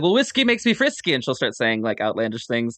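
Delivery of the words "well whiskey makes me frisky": put-on Irish accent. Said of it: "she's like".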